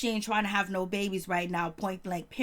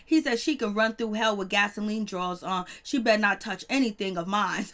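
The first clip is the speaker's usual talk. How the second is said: ordinary talk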